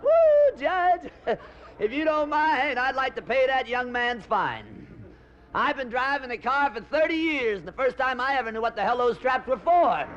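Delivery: put-on high, excited old man cackling. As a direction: squeal it like a thrilled old codger. Little old man stands up in court.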